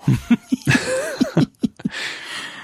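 a big high burst of laughter; squealing laugh. Real, not acted. Laughs at own joke.

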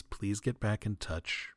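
ordinary delivery

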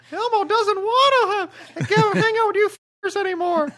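very high giggly puppet voice; squeaky Muppet, laughing through; Elmo voice laughing. Pretend.

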